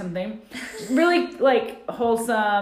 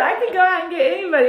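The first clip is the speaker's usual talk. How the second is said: much higher, louder and fast mock voice; quoting Angela; 10 semitones higher, 7 dB louder, faster than usual. Retelling Angela's boast to the cohost, high and fast. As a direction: rattle it off high like a sassy boast. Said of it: "She said".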